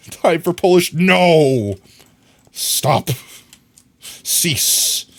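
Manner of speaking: loud, protesting no; mock protest. Refusing chat's joke, loud and playful.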